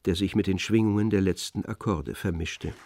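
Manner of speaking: ordinary delivery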